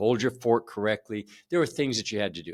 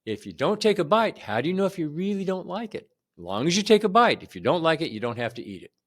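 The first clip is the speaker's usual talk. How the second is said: higher, coaxing mom voice; mother's reasoning; 6 semitones higher, faster than usual. Retold or imitated. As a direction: coax the child like a patient mother. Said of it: "what she told me was".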